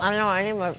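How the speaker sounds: talking while chewing; words through a mouthful of food; mouth full. Real, not acted. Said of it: "I didn't mean to talk with my mouth full".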